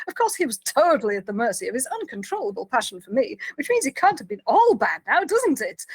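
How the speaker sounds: theatrical retelling with swooping pitch; big and boastful. Retold or imitated. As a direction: play the boastful diva telling her ordeal. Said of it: "loud and big and boastful".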